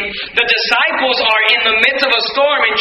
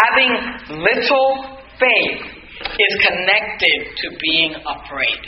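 slow, grave emphasis; 5 semitones lower, 4 dB quieter, much slower than usual. Shown as a demonstration. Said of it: "I want you to see".

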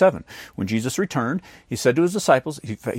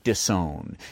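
usual speaking voice

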